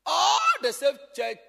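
loud, high burst; shout. Real, not acted. Pastor shouting a point at church leaders.

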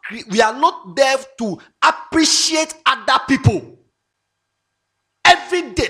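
high, loud, building sermon; loud, fervent preaching; preaching loudly. Real, not acted. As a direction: preach it to the crowd. Preaching at full force.

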